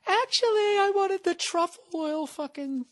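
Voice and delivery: mock the girlfriend changing her order; imitating girlfriend. Girlfriend calling back about the ice cream, high mocking voice. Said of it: "your fucking girl's gonna call".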